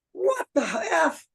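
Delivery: high, drawn-out mock disbelief; retold outburst. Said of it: "Like that".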